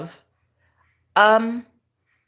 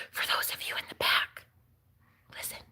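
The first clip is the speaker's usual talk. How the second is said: mock whisper; 13 dB quieter than usual. Mock secret to the viewers.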